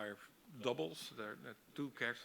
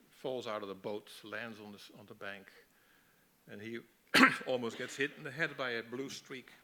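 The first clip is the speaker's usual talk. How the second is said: normal talking voice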